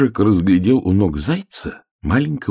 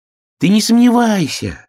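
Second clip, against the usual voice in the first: put-on very high, loud frog child; insistent little frog; 6 dB louder than usual. Little frog assures the doubting hare, insistent. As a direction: pipe up high and sure.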